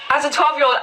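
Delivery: ordinary delivery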